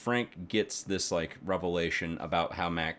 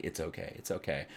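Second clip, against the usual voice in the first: hushed and gentle, much softer, like soothing someone in tears; soft comforting; 3 semitones lower, 10 dB quieter than usual. Retold or imitated. Woman playing God hushes the crying Mac, soft and soothing. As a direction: murmur it softly to calm him.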